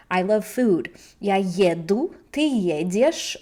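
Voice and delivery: usual speaking voice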